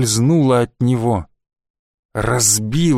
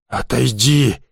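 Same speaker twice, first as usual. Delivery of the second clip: hoarse wheeze; slower than usual. A narrator's reading. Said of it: "просипел он".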